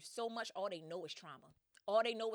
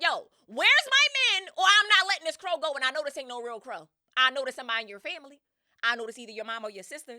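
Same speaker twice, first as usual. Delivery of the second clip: much higher and louder, demanding; furious shout; 5 semitones higher, 16 dB louder than usual. Retold or imitated. Odysseus threatening Circe over the crow, shouting. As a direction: roar the threat while choking the crow. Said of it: "and was like".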